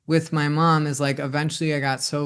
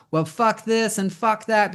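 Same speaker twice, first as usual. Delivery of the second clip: higher, breezy throwaway cursing; casual mock cursing; 6 semitones higher than usual. Parent shrugging off a child's swearing, breezy mock curse. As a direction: toss the swear back lightly, no sting. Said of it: "just to be like".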